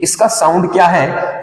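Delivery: ordinary delivery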